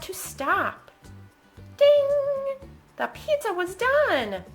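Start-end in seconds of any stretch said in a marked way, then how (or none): 1.8-2.7 high, loud ringing ding; oven bell ding. A narrator's reading. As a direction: imitate a timer bell, held high. Oven timer sound in story.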